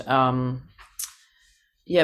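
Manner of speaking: normal talking voice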